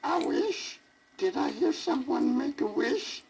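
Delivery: put-on high squeaky old fairy voice. A narrator's reading. Fairy godmother squeaking down at the sad elephant. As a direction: squeak it out like a tiny old lady. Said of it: "a strange voice squeaked".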